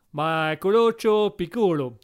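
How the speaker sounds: slow gibberish in a hammed-up Italian accent; sing-song mock Italian, drawn out. Examiner rambles in hammed-up mock Italian. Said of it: "Tellement mélodieux".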